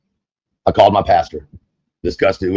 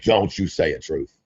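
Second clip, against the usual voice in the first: soft, lower warning; a hushed lower plea not to say it; 3 semitones lower, 7 dB quieter than usual. Acted. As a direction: warn under the breath. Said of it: "Self was like".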